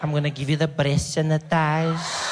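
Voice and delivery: slow, lightly voiced seductive purr; flirty cashier. Cashier flirting back at the customer, slow and suggestive. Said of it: "thought I was trying to have sex".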